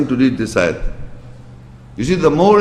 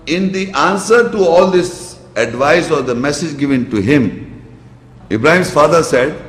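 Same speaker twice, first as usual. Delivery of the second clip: usual speaking voice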